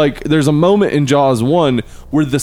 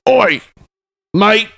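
high, loud mock Aussie yell; angry Australian accent; 8 semitones higher, 3 dB louder than usual. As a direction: shout it like an offended Aussie. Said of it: "that's not how I talk".

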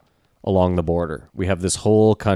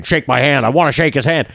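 raised, higher-pitched imitation of a pushy man; aggressive demand; 6 semitones higher, 4 dB louder than usual. Podcaster mimicking a man demanding a handshake, aggressive. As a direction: mimic someone loudly insisting. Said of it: "aggressively was like".

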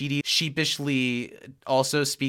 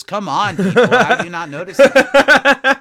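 exasperated outburst; 6 semitones higher, 13 dB louder than usual. Retold or imitated. Janet exasperated at unnoticed forgery.

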